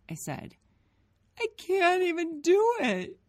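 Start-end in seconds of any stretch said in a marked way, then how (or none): 1.4-3.2 high, loud wail; crying child. A narrator's reading. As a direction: sob it out helplessly. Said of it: "Gabe cried again".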